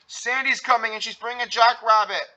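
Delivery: high, loud panicked announcement; alarmed cry. A narrator's reading. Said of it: "cried Patrick".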